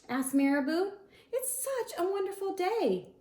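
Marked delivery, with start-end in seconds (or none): 1.3-3.1 high, sing-song cheer; lightly voiced cheerful bird. Teacher voicing Marabou, lightly high and cheery. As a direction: chirp it brightly, higher up.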